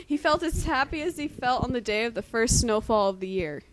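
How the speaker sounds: loud, hammed-up dramatic reading. Friend reading a gross fanfic aloud, loud mock-dramatic reading. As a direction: belt out the fanfic like a stage performance. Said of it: "I'm just gonna read this like a woman".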